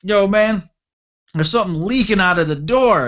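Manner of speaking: high, loud mock warning; buddy hollering a warning, acted out; imitated alarmed buddy. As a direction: call it out high through a closed door. Buddy alerts him through the door.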